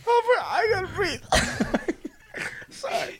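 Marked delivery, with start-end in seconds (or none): 0.1-2.7 laughing. Real, not acted. Cracking up, can't breathe.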